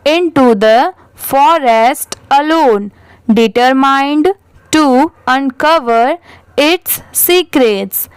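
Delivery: very slow, word-by-word model reading; repeat-after-me reading. Shown as a demonstration. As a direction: read slowly with pauses for learners to repeat. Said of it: "मेरे बाद आप repeat करिए".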